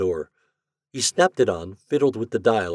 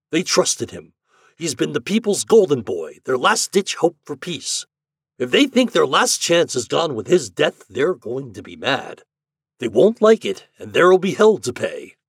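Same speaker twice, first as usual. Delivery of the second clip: urgent warning; 7 semitones higher, 8 dB louder than usual. Acted. Hart warns of public anger.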